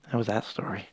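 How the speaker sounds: soft unvoiced wrap-up; whisper. Real, not acted. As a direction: whisper the ending. Closing the tale.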